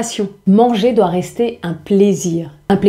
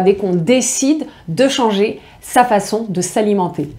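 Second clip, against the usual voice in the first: ordinary talk, nothing marked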